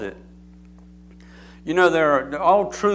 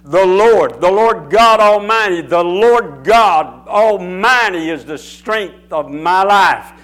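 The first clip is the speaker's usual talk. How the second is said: loud, raised preaching shout, drawn out slowly; full-force proclamation; 3 semitones higher, 8 dB louder, slower than usual. Shown as a demonstration. Preacher proclaims to congregation at full force, loud and slow. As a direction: thunder it out like a declaration of faith. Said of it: "I put a stronger deposit".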